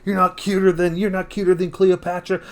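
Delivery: 성난 관중. Retold or imitated. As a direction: imitate indignant fans protesting an insult. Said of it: "everyone gets so mad".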